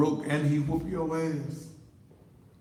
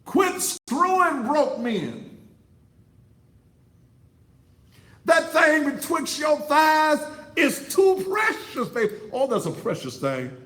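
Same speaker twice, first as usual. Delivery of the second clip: full-force preaching; 9 semitones higher, 5 dB louder than usual. Acted. Preacher hollering at the women in the pews, full-force preaching. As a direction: preach it at the congregation like a revival climax.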